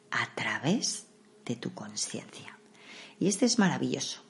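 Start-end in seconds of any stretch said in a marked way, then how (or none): 0.1-2.6 whispered, slowed phrase. Shown as a demonstration. Describing the yoga nidra journey.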